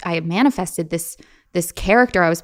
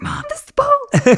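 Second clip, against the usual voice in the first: put-on much higher, thrilled kid voice; excited kid; 4 dB louder than usual. Girl showing her mom the ball, much higher and thrilled. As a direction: squeal it like a kid who found it. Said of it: "I was like".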